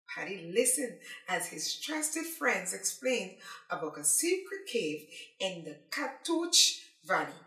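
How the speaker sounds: usual speaking voice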